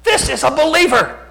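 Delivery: high, loud triumphant realization; excited exclamation. Retold or imitated. Preacher retelling his thought about the young man, excitedly exclaimed.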